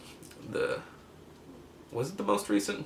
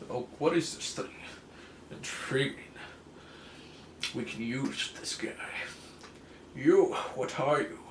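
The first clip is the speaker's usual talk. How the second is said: lightly voiced curious scientists; mock-intrigued lab men. Scientists voiced finding Hulk.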